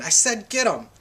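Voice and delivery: storyteller acting a forceful yell. A narrator's reading. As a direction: yell it, demanding to be obeyed. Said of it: "George yelled again".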